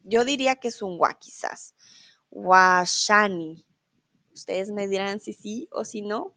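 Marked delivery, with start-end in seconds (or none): 2.3-3.6 "Washani" drawn out very slowly, falling; "Washani" pronunciado muy despacio; saying Washani. Shown as a demonstration. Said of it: "en árabe se diría". ("Washani" muy lento y descendente)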